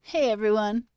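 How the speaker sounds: lightly hammed-up hello to a crowd; mock conference greeting.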